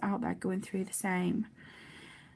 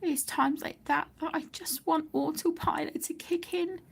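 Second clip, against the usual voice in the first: choked whisper, pitch higher, close to tears; getting emotional; 6 semitones higher than usual. Real, not acted. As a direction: fight back real tears as you say it. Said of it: "I'm gonna get emotional".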